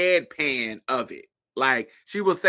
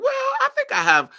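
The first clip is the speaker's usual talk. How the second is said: much higher, timid little voice; imitating Andy; 3 dB louder, faster than usual. Andy answering Miranda, high and meek. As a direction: squeak it out meekly. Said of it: "she was like".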